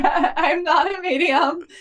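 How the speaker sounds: high-pitched words through laughter; laughing. Real, not acted. Woman joking about her failed medium experiment, laughing.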